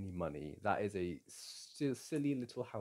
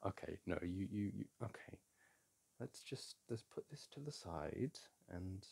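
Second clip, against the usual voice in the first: much softer, half-whispered calming; hushed soothing; 7 dB quieter than usual. Real, not acted. Calming upset partner while stifling a laugh, hushed. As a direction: hush to settle him down.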